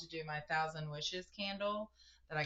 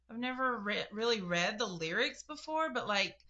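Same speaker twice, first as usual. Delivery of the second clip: ordinary talk, nothing marked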